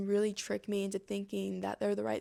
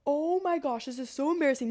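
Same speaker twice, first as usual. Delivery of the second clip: raised, mock-mortified inner cry; mortified thought; 5 semitones higher, 4 dB louder than usual. Choker thinking as everyone turns to stare, mortified. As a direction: act out a rising wave of embarrassment. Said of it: "And I was thinking".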